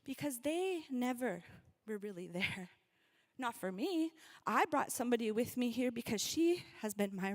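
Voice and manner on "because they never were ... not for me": ordinary delivery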